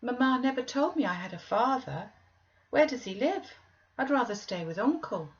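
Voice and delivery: put-on high, fretful child's voice; a sickly boy pleading to stay; anxious young boy. A narrator's reading.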